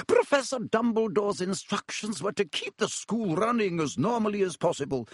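crisp disapproving governess; high, clipped stern teacher. A narrator's reading. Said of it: "scowl even more darkly".

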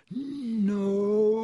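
high, long-held yell. A narrator's reading. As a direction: cry out, stretching the vowel. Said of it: "Ron yelled".

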